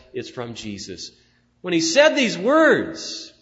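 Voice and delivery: loud, high, ringing preaching climax; full-force preaching. Real, not acted. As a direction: build it up and proclaim it.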